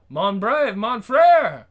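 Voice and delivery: loud, high sneering French words; mock him in his own tongue; mocking taunt. Billy taunts the Frenchman, jeering.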